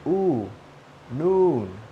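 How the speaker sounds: OO and NOON with drawn-out tense vowel; long OO. Shown as a demonstration. Teacher drilling afternoon, long OO in NOON. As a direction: stretch the "oo" through NOON. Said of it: "This has a long oo sound".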